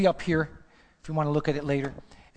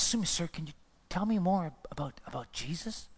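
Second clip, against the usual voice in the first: tearful quiet plea; 9 dB quieter than usual. Retold or imitated. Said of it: "He says".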